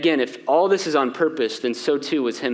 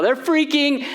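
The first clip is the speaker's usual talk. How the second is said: higher, mock-panicked pleading; freaking out; 7 semitones higher, slower than usual. Pastor acting the frightened disciples, mock panic. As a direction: ham up the frantic disciples. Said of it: "Kevin McAllister style".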